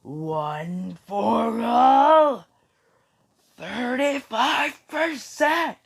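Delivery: very drawn-out, rising power-up yell; slow, full-voiced anime attack call; battle scream. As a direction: stretch every word into a high scream. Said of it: "as he screams".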